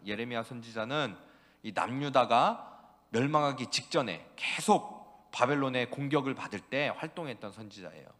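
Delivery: usual speaking voice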